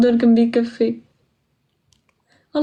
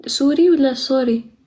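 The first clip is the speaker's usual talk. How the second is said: ordinary delivery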